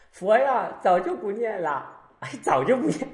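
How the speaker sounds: high-pitched mock brush-off; dismissive old devotee. Old devotee answers the master about chanting, dismissive. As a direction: imitate an old person waving the question away.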